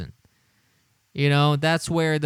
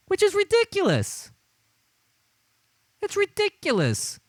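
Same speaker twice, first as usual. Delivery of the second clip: scoffing. Real, not acted. High-pitched scoffing.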